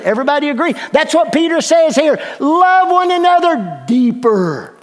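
loud, high preaching that builds; full-force sermon voice; fired-up preaching. Acted. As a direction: preach it with rising fire.

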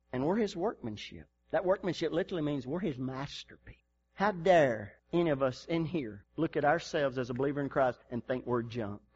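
normal talking voice

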